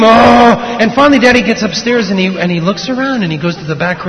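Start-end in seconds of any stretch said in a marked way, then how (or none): 0.0-0.6 short loud pretend-bear roar. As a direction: roar while chasing the boy. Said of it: "father's coming after him".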